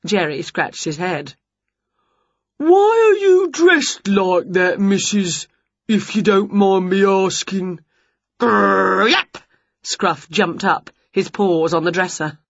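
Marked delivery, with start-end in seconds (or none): 2.6-7.8 puzzled giant neighbour. A narrator's reading. Jerry asking Winnie about her costume, puzzled. 8.4-9.4 rolled growl into a sharp yap; growling dog. A narrator's reading. Scruff jumping up at the dresser, growling.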